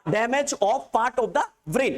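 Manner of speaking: drawn-out dictation, word by word; slow dictation. Real, not acted. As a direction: dictate slowly for students writing it down. Dictating a definition to write down.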